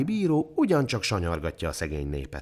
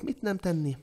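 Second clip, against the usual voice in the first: ordinary delivery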